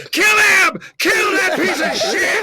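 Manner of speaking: high, loud bloodthirsty yell; frenzied mock crowd holler; imitated fever-pitch mob. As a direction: whip up the mob with a high shout. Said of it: "got to a fever pitch".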